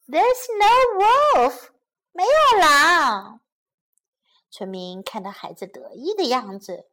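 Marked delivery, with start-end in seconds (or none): 0.1-3.4 loud, high, drawn-out gloating; smug. A narrator's reading. Shepherd boy triumphs over the fooled villagers, smugly gloating. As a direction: crow it slowly, very pleased with yourself. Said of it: "得意地说".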